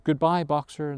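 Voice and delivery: ordinary delivery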